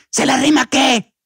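high, demanding question; acted exasperation. Angry host demanding the puppeteer explain, high and outraged. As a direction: pounce with mock outrage. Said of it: "cuando se enojaba".

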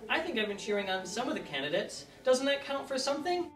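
high, soft, whining question; whiny little voice. Pretend. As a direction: plead like a whiny student. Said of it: "in a whiny little voice".

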